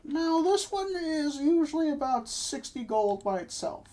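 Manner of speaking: chirpy shopkeeper pitching wares. Acted. GM voicing shopkeeper.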